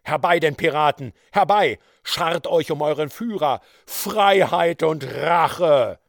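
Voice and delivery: loud, defiant battle cry; rally your men with fury; pirate chief roaring his men to him; hoarse rallying yell. A narrator's reading. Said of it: "schrie er mit heiserer Stimme".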